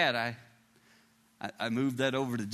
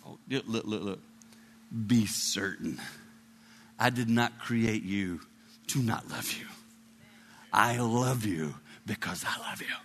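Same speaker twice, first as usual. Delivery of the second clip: low, gentle fatherly assurance; tender reassurance; 6 semitones lower than usual. Acted. God looking right down at you, tender reassurance.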